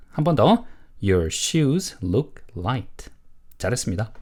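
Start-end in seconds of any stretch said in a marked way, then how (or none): 1.0-3.1 slow, word-by-word model statement; model reading. Shown as a demonstration. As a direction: read slowly for learners to repeat. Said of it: "두 번". (천천히 또박또박 읽는 시범)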